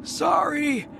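high, slow shouted croak; wry alien joke, croaked high. A narrator's reading. Beliath teases in alien voice.

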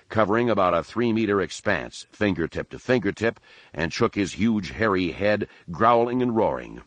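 ordinary delivery

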